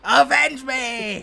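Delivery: slow, high, hammy dying plea; overacted death scene. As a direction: drag it out like a dying villain. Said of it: "He just".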